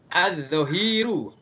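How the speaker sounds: "Az-zohiru" slowly, doubled z and no L; slow model reading of "Az-zohiru"; silent alif lam. Shown as a demonstration. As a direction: draw out "Az-zohiru", straight from A into z. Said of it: "alif lamnya nggak dibaca".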